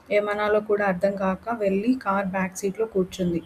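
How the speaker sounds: ordinary delivery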